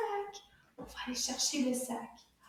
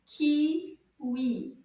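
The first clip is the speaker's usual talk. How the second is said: Kiwi split as KI, pause, WI; loud, separated beats on Kiwi; syllable by syllable; 5 dB louder than usual. Shown as a demonstration. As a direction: count out the two syllables of Kiwi. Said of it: "Deux syllabes".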